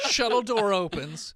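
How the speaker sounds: slow, laughing narration; laughing through words. Acted. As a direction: say it while laughing. Repeats line through laughter, slow.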